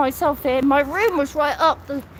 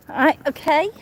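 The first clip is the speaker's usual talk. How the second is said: ordinary talk, nothing marked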